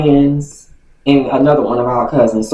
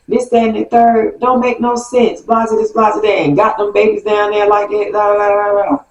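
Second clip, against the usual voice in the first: mom going off; 7 semitones higher than usual. Retold or imitated. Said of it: "going off".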